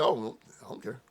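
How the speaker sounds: soft, offhand shrug of a line; dismissive mutter. Retold or imitated. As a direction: toss it away quietly, unbothered. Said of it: "When he said it, I said".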